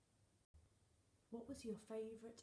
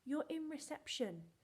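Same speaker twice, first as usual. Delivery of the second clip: a touch of a firm, reassuring mum, higher and louder; mum's reassuring words; 6 semitones higher, 6 dB louder than usual. Retold or imitated. Teacher quoting her mum's encouragement, higher and louder. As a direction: gently put on mum's firm voice. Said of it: "But she said".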